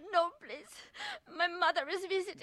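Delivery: high, crying plea; tearful pleading. Acted. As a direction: beg through tears. Wife pleading with abusive husband.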